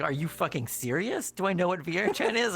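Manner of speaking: high, excited mock outrage at the question; excited disbelief. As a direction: play the kid's thrilled disbelief. Kid shocked at VR chat question.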